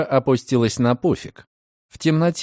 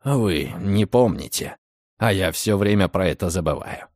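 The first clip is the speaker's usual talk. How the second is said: low calm male voice; 6 semitones lower, 3 dB quieter than usual. A narrator's reading. (низкий спокойный голос)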